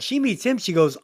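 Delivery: ordinary talk, nothing marked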